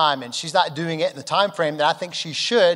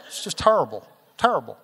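put-on Alamance County accent. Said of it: "as they might say in Alamance Count".

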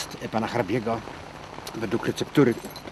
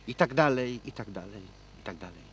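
fading away; 2 semitones lower, 6 dB quieter than usual. Acted. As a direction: let it die away to almost nothing. Breaks off recitation with repeats.

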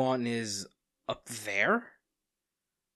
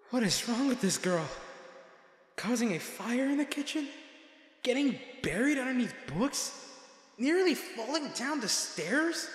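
pitched high, listing her mishaps in disbelief; exasperated rant; 11 semitones higher than usual. Acted. Bodyguard ranting to himself about her clumsiness, high-pitched disbelief. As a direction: vent the frustration to yourself.

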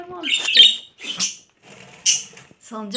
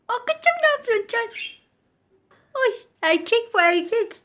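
high pet voice; 11 semitones higher than usual. Real, not acted. High pet talk to bird.

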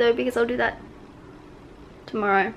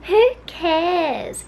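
imitating a kid's shout; 6 semitones higher than usual. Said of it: "kid in the background who just screams out".

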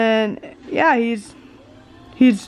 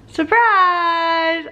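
excited; 6 semitones higher than usual. Acted. Announcing dog sitting.

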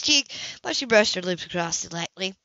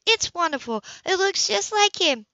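delighted praise; 9 semitones higher than usual. A narrator's reading. Anna praising the sketch.

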